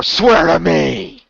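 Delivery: drawn-out Batman growl. Retold or imitated. Correcting a friend on which hero he sounded like, Batman growl. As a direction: imitate the masked hero's disguised rasp. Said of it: "less Arrow voice, and that was more".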